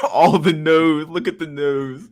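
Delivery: high-pitched, amused outburst; real laughter lifting the voice high; laughing. Real, not acted. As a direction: laugh through the words, stretching the end. Laughing at the poll results.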